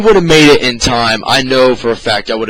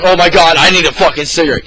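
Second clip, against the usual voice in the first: frantic retold panic; 5 semitones higher, 5 dB louder than usual. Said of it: "I'm just sitting there like".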